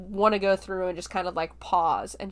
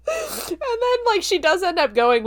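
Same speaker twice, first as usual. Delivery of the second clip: real laughter breaking through high; amused laughing narration; 11 semitones higher, 4 dB louder than usual. Real, not acted. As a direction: giggle through the words.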